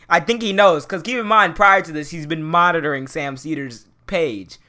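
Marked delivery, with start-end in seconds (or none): none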